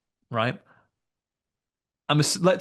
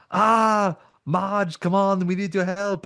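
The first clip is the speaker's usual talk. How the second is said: Arnie impression; 9 semitones higher, 3 dB louder, slower than usual. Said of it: "and Jericho says".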